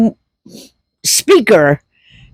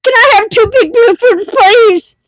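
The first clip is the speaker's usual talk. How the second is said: much higher and fast; rushed laughing order; 13 semitones higher than usual. Retold or imitated. Mom finally gets the order through, laughing. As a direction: blurt it out before cracking up. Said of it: "So I said".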